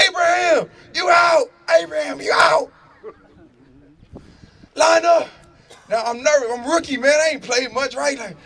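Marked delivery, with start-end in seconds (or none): 0.1-5.3 urgent high shouts to teammates; calling out. Retold or imitated. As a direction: holler across a football field. Rookie yelling orders on the field.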